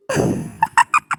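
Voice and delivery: high, snickering giggle; giggling. Acted. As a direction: burst into a mocking tee-hee. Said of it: "तू खिकी खिकी करके हंस रही". (खिकी खिकी करके हँसना)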